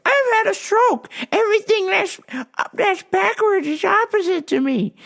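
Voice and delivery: put-on very high-pitched old man; flustered old customer. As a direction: explain yourself in a high customer voice. Voices old customer in story.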